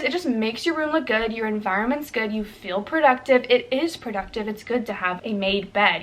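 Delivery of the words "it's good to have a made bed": normal talking voice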